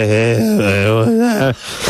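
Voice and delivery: hammed-up sloppy drunk greeting; lightly voiced leering cousin; mock drunk caller. The man mocks a drunk cousin's call to the girl, hammed-up sloppy greeting. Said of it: "You call up and it's like".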